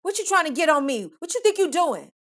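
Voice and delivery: high, loud accusing rant; imitating his furious scolding; angry screaming. Boyfriend screams at her in the car, angry. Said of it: "he starts screaming".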